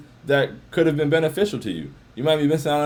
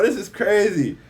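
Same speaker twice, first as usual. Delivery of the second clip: usual speaking voice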